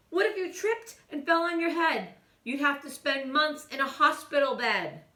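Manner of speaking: high, loud worried scolding; scolding mother. A narrator's reading. Mother scolding her son after his stunt.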